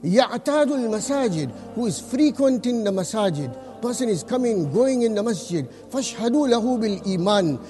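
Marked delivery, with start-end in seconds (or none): none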